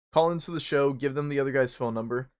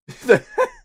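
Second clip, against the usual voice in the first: sudden shrieking laugh; loud high laugh; 12 dB louder than usual. Real, not acted. Laughing at his own boastful joke, loud and high. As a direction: burst out laughing at your joke.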